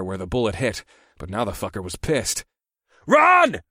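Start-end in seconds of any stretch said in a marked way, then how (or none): 3.1-3.6 very high, loud urgent yell; shouting. A narrator's reading. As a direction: scream the order. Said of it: "I shouted".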